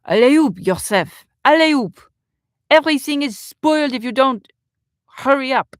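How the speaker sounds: high, loud impatient call; French chef's shout. Acted. French chef hurrying waiter Joseph, accented impatient shout. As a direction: bellow orders as a French chef. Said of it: "and shout".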